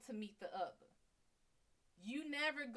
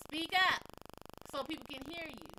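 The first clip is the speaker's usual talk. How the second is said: louder, higher call to speak up; 5 semitones higher, 6 dB louder than usual. Shown as a demonstration. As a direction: urge a child to speak up. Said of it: "what we tell kids".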